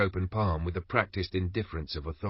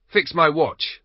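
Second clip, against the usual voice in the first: higher, louder mock command; mocking, enjoying himself; 8 semitones higher, 6 dB louder, slower than usual. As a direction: lord it over the old man. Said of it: "enjoying himself".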